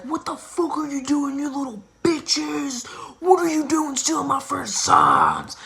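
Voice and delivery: high, loud mocking yell at the kids; imitating an angry neighbor. Neighbor catching sign thieves, mock angry yell.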